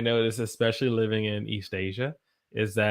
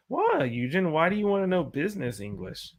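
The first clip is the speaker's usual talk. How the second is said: playful fake challenge, higher and faster; mock suspicious question; 7 semitones higher than usual. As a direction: tease the viewer as if suspicious. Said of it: "I'm joking".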